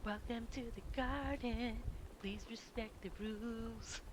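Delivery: prim, dainty garden sign voice; mock meme voice. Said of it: "And I'm just like".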